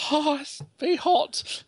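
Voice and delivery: high, slow mock squeal; girlish squeal. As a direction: squeal as if lowering into a too-hot bath. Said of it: "I squeal like a girl".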